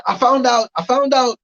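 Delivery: excited high build. Acted. Comic building the dishes joke, pitched high.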